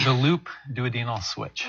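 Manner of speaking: slowed-down, measured repeat of the term. Shown as a demonstration. Surgeon repeats the procedure name for the hosts, slowly. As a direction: say it slowly so they can follow. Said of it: "Yeah, say that slowly".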